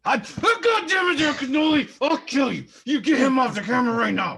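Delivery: screaming furious outburst, high and loud; berserk rage. Real, not acted. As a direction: explode in a yelling rage. Mock rage at cat on camera.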